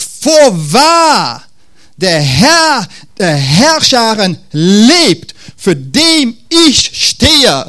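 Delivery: a prophet making a solemn formal vow; formal solemn oath. Shown as a demonstration. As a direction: swear it stiffly and ceremoniously, drawing out each word. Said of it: "er wird jetzt formell".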